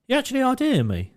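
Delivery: high-pitched imitation of an amazed customer; mock disbelief. Mimics woman amazed at ID check.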